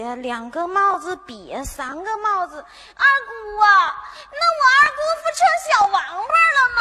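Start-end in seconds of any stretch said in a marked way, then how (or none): 4.3-6.8 higher, louder, fast jibe; comic outburst. Acted. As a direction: rattle off the joke. 丫鬟逗二姑. (又快又高声打趣)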